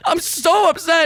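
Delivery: high, mock-tearful wail; hammed-up upset whine; imitated crying. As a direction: act out sobbing to a husband. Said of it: "cry, be like".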